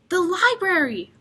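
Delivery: shout. Acted. Boy answering the chief, eager shout. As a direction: blurt the answer out. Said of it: "I shout".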